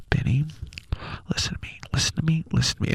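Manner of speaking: intense, partly whispered mock scolding; close mom whisper. Imitating mom scolding in church, close-up whisper. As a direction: whisper urgently right into the child's ear. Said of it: "she would whisper so close to my ear".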